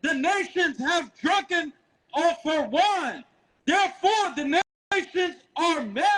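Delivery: high, slow, ringing scripture reading; street-preaching Bible reader; scripture declamation. Acted.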